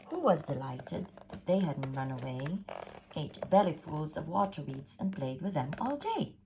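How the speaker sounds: ordinary delivery, nothing marked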